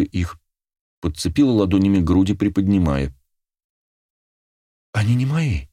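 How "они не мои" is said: higher, dismayed little gasp. A narrator's reading. Girl examining her changed body, gasping. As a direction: breathe it out in shocked dismay. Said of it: "охнула она".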